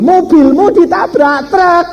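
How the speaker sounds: very high, slow imitated scream; hammed-up drawn-out shriek; wife's scream. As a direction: mimic a wife screaming the news, stretched out. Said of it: "Istri saya teriak lagi".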